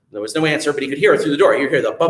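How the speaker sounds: plain reading voice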